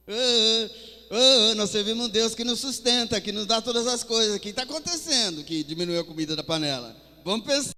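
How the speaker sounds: loud, fired-up preaching; preaching at full force. Acted. Preacher stirs the church about the empty pan, loudly. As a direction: rouse the congregation with a loud cry.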